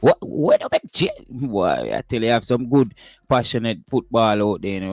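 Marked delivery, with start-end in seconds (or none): 0.0-1.2 mock a fan losing it over the coach's changes; hammed-up outraged fan going ever higher; imitating an upset fan. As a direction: pitch climbing higher and higher into an outraged squeal. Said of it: "basically upset and they make the door say".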